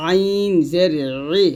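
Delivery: আইন জের ই with ই squeezed in mid-throat; pressed ayin i. Shown as a demonstration. Teacher drilling the letter for learners, pressed ayin. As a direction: tighten the throat on আইন and ই, held. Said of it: "চাপ দিয়ে".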